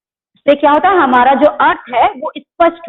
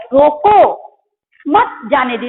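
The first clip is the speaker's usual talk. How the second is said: stressed रोको, a stop, then मत जाने दो together; pause after रोको; slower than usual. Shown as a demonstration. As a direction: halt after रोको before saying मत. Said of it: "अल्प विराम लगा है".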